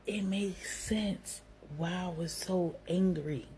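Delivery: slow breathy whisper of realization; gasp, then whisper it slowly as it dawns; hushed realization. Retold or imitated. Realizing she is pregnant after the fight, slow hushed whisper.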